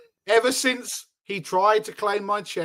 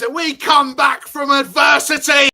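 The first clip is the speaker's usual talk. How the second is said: gloating shout; 5 semitones higher, 6 dB louder than usual. Acted. Gloating chant as Spurs fan.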